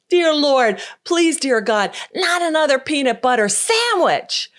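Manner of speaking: hammed-up prayerful exclamation; mock exclamation. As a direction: cry out to the Lord in exaggerated despair. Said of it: "he exclaimed".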